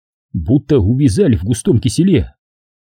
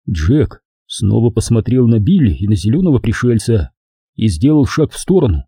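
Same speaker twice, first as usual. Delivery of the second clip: ordinary delivery, nothing marked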